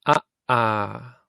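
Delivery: Ah short, ah held long; vowel pair short and long; short then long ah. Shown as a demonstration. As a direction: clip the first Ah, stretch the second ah. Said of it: "You just say the ah longer than you say the ah".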